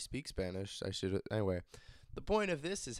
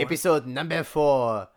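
louder, fake French announcement; bad French accent; 5 dB louder than usual. Host answering which episode it is, bad French accent. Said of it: "This is supposed to be French".

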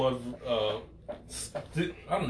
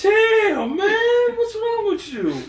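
mock outrage; 4 dB louder, much slower than usual.

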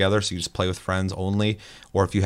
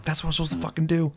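defensive retort; 9 semitones higher, faster than usual. Retold or imitated. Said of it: "I'm like".

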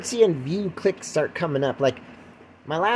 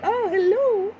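high, affected cooing hello; pretend celebrity greeting; slower than usual. Podcaster greeting fans in Malaysia, hammed-up celebrity. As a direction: sing it out like a star. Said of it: "I'll go to Malaysia and be like".

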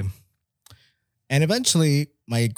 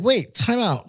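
sudden realization; 11 semitones higher, slower than usual. Retold or imitated. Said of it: "And I was like".

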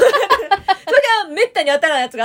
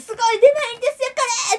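much higher, louder, fast sales pitch; mock excited clerk; 11 semitones higher, 4 dB louder, much faster than usual. Clerk boasting the prize is rare, in an imitated excited voice. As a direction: talk up the rarity like a pushy clerk. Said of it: "って言われたけど".

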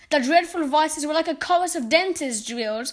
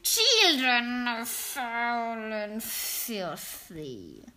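Grand High Witch thundering; 2 semitones lower, 4 dB quieter, much slower than usual. Acted. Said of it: "thundered the Grand High Witch".

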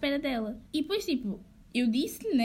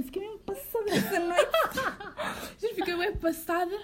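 normal talking voice

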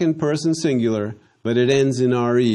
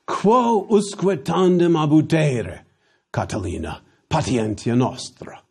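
full Latin orator line; 4 semitones higher than usual. Shown as a demonstration. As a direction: declaim it like Cicero in the senate. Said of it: "A more idiomatic way".

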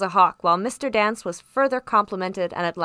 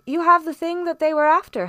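eager doctor; 7 semitones higher, 4 dB louder than usual. A narrator's reading.

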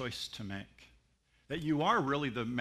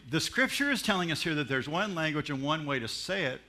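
ordinary talk

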